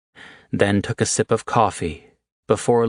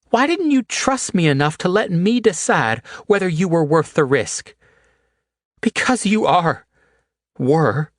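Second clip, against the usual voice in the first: much higher, louder anguished woman; Haley, hurt and pleading; 13 semitones higher, 3 dB louder than usual. A narrator's reading. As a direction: pour out her hurt in a high voice. Haley's hurt outburst, high voice.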